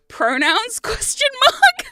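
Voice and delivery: high, loud squeal on the punchline; cracking up. Retold or imitated. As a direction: read the text out while bursting into laughter. Reading friend's text, cracking up.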